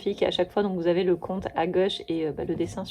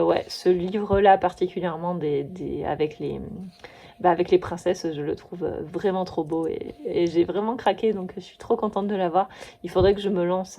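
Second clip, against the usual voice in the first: ordinary delivery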